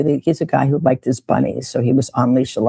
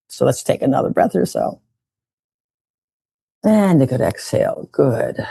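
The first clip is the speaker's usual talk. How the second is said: guided breathing. Acted. Guides breath exercise again.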